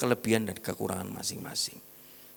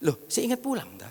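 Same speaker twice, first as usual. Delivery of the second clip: sarcastic jab; 5 semitones higher than usual. Retold or imitated. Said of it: "saya bilang gitu".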